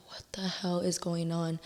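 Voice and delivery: voiceless whisper, disbelieving; stunned whisper. Retold or imitated. Reading live comments full of lies, stunned whisper. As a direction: breathe it out in shock, no voice.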